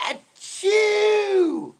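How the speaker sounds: high, very loud shouted achoo; huge mock sneeze. Shown as a demonstration. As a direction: let rip a sneeze heard blocks away. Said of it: "I sneeze big".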